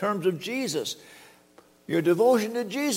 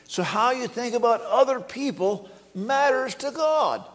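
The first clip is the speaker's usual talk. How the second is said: each word drawn out, pitched up; slow emphatic restatement; 6 semitones higher, slower than usual. Real, not acted. Preacher drives home his point, slow emphasis. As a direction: land the point slowly and deliberately.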